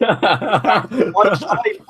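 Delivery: laughing through the words; real laughter. Real, not acted. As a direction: break up laughing mid-sentence. Laughing at the sequel bait.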